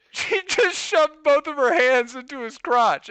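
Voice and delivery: normal talking voice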